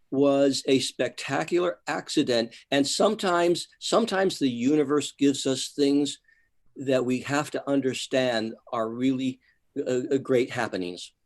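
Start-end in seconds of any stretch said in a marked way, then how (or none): none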